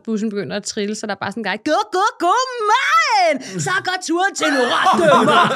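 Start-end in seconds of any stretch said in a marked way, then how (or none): 1.7-5.5 high, loud laughing party yell; party bus guy shouting to start the trip. Retold or imitated. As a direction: hype the bus like a party host.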